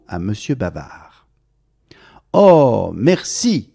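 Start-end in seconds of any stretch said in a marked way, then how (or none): 2.3-3.7 high, loud burst of thanks; a chatterbox thrilled to get his hat; delighted thanks. Acted. Mr Bavard thanking the hatter, high and delighted. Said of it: "dit monsieur Bavard".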